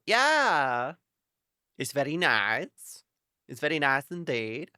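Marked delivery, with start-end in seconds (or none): 0.1-0.9 loud happy cheer; excited. Real, not acted. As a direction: burst out with delight. Excited about new desktop.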